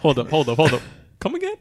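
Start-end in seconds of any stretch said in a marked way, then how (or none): 1.2-1.5 surprised. Real, not acted. Host reacts to odd plot point.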